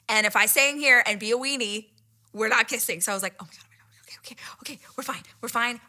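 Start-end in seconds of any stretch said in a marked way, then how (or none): 3.4-5.8 frantic whispered pep talk; hushed, panicky muttering; nervous self-talk. Retold or imitated. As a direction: whisper reassurance to yourself.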